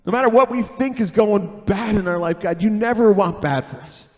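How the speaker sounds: earnest spoken prayer. Acted. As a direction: pray it over the church. Leads a prayer.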